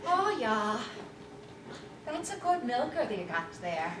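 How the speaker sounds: lightly voiced country farmer admiring a machine; farmer accent. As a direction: farmer chatting about the new milker, light rural accent. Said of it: "farmers have to have accents".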